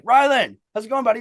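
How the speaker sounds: high, loud cheery call to a buddy; loud greeting. Retold or imitated. Rolling up to his friend, calling out loud. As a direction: belt out a happy hello.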